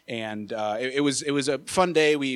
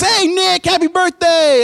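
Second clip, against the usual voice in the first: imitated yell, much higher and much louder, out of a car window; 13 dB louder, slower than usual. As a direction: holler it across the parking lot. Driver yells from car window.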